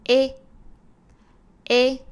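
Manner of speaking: loud, clear 诶 said as a pure e; Spanish vowel e. Shown as a demonstration. Teacher drilling the Spanish vowel e for students. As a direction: model 诶 as a clean Spanish e. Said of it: "第二个是".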